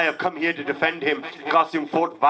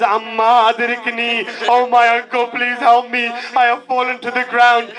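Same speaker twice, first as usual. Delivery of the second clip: put-on louder, higher voice of a fallen boy calling his uncle; boy crying for help; 7 semitones higher, 7 dB louder than usual. Qasim on the ground begging his uncle to save him, loud anguished plea. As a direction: call out for rescue from the ground.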